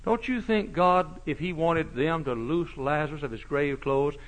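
ordinary talk, nothing marked

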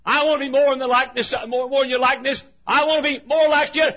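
high, loud, pleading cry to God; desperate pleading. Acted. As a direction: beg him not to stop, shouting. Jacob pleading, wrestling with God.

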